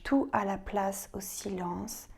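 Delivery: ordinary talk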